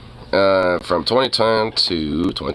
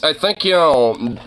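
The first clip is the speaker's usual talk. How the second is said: ordinary talk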